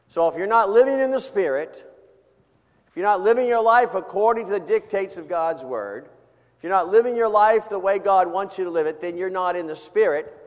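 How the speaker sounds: high, loud, building sermon climax; fiery preaching. Acted. Pastor warning the congregation about not living in the Spirit, loud and fervent. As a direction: drive the warning home to the pews.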